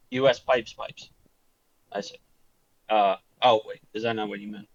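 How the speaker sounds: ordinary delivery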